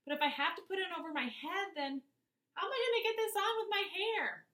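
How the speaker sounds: put-on high, worried child's voice; anxious fretting. A narrator's reading. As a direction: fret about ruining the hair. Maddie panicking about her hair.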